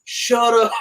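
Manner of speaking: high, loud disbelieving shout; imitating the buddy's amazed outburst; mock stunned shout of disbelief; astonished. Buddy's astonished reply retold.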